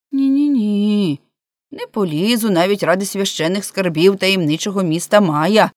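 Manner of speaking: ordinary delivery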